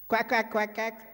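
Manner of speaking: quacking chorus. Retold or imitated. Man and ducks quacking together.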